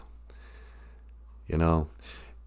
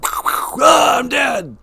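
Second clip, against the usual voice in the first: put-on much higher kid shouting at play; kids playing guns; 8 semitones higher, 3 dB louder than usual. Kindergartners playing guns in the yard, shouting and high. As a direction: yell like a kid playing cops and robbers. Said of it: "'cause you're constantly playing guns".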